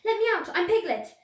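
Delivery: high, loud desperate cry. A narrator's reading. Piglet in the bath crying out to Kanga, high yell. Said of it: "cried Piglet".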